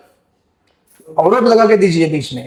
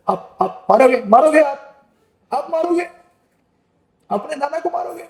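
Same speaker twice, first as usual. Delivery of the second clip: put-on rising higher and higher, softer, whimpering like a frightened crying child; sobbing child; 8 semitones higher, 4 dB quieter than usual. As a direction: plead through sobs, the pitch climbing as the voice fades. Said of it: "रो रो के बोलते हैं, सिसक सिसक के". (सिसक सिसक के रोते बच्चे की तरह, ऊँची और धीमी आवाज़)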